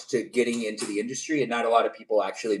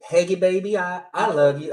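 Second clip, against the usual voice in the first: higher put-on Southern sweet-talk; Southern accent; 5 semitones higher, 4 dB louder than usual. Streamer trying a greeting for viewer's mom, Southern accent. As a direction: pitch it up with a Southern twang. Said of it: "has to be in a Southern accent".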